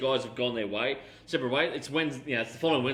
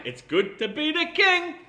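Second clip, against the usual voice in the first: high, slow, grand announcement of king; hammed-up royal proclamation; 5 dB louder, slower than usual. Co-host echoing the famous line, high and slow. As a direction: stretch out king like a proud monarch.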